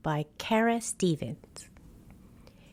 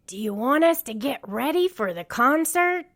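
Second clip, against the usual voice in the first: excited; 7 semitones higher, 4 dB louder than usual. A narrator's reading. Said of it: "Rubble was dancing with excitement".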